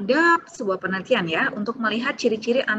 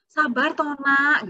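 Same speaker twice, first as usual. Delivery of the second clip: slow soothing parent; 6 semitones higher, much slower than usual. Retold or imitated. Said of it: "Sabar itu abstrak".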